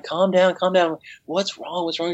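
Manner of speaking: repeated soothing questions. Retold or imitated. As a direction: talk a crying friend down. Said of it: "I'm like".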